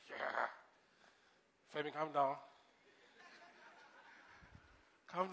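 retold self-soothing; mock self-calming. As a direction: talk yourself down quietly. Calming himself after the crash.

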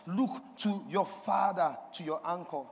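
normal talking voice

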